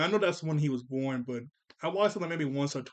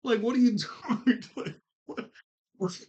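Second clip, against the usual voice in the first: laughing disbelief; 7 semitones higher, 3 dB louder than usual. Real, not acted. Mocking the kids' painting.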